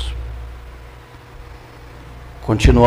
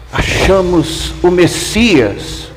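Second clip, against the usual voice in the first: slow, higher-pitched proclamation of great news; excited announcement; 6 semitones higher, much slower than usual. Acted. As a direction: draw out the big news, pitched up. André runs up with news.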